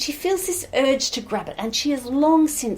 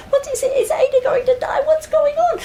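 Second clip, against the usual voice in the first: very high, loud frantic questions; agitated crowd; 5 dB louder, faster than usual. Acted. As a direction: fire off panicked questions up high. Worried crowd asks in alarm.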